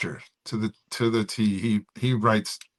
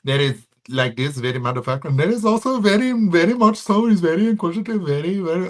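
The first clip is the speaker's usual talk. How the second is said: higher-pitched mock Indian accent; 7 semitones higher than usual. Shown as a demonstration. Comic demonstrates his different Indian guys, hammed-up Indian accent. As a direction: another comic Indian guy, voice climbing high. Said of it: "four different Indian guys".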